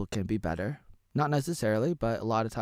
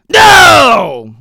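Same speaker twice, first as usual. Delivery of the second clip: frustrated shout; 20 dB louder than usual. Acted. Outburst of frustration.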